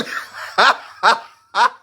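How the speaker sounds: high, loud peals of laughter; real uncontrolled cackling; big loud laugh. Real, not acted. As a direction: laugh hard and long. Laughs at a viewer comment.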